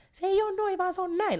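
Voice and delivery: put-on high voice of a corrector; mock correcting. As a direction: pipe up high as if setting someone straight. Imagined correcting of every person.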